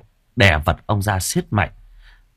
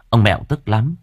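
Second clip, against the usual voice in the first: usual speaking voice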